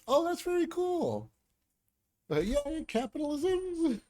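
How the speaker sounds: high, slow mock delight at "capitalism"; a hammed-up chipper yay; sarcastic cheer. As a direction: gush with fake enthusiasm, dragging it out.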